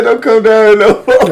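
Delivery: high, slow, laughing imitation; mock kid voice. Cohost quoting the kid, high and laughing. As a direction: mimic the kid, cracking up.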